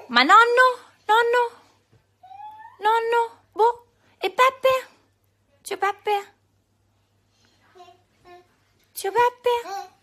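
high playful asking. Real, not acted.

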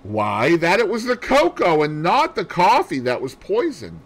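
high, loud, eager explaining; triumphant amateur sleuth; excited deduction. A narrator's reading. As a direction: spill out the theory excitedly. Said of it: "My pent-up excitement burst forth".

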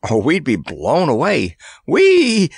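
high, gleeful exclaiming; cheery call. A narrator's reading. Randy sheltering under Kabumpo in the gale, calling cheerily. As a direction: whoop with glee over the wind. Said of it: "he called up cheerily".

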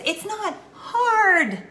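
high, long-held HARD; drawn-out HARD. Real, not acted. Speaker to friends after fumbling, drawn-out emphatic HARD. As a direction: stretch HARD out high and loud. Stumbled intro, insisting it is easy.

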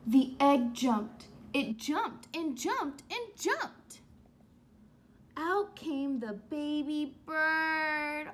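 slow, loud build of suspense; loud, drawn-out storybook suspense; dramatic slow reading. A narrator's reading. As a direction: draw it out, each jump bigger. Dramatic read-aloud.

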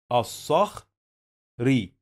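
الصخري said slowly as as-sakh, pause, ree; slow sounding-out of الصخري in two pieces; split into parts. Shown as a demonstration. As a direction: leave a clear gap before the last syllable of الصخري. Teacher splits word before joining it.

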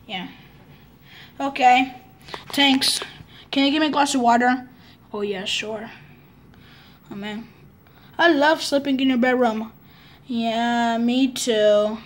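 normal talking voice

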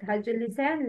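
ordinary delivery

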